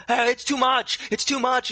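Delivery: high, loud mock distress; hammed-up strained wail; overwhelmed cry. Exhausted magician lying against the dude, high mock distress.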